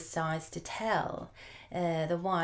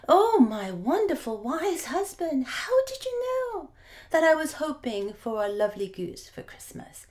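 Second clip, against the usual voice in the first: louder, soaring higher and higher with joy; wife exclaiming; 8 semitones higher, 5 dB louder than usual. Acted. Wife exclaiming over the goose, loud and climbing high. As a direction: gush with rising delight. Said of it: "the wife exclaimed".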